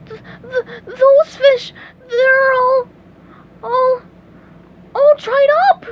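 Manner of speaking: high stammering build to a shout; horrified stammer. A narrator's reading. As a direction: stutter in mounting terror. Horrified at dried fish.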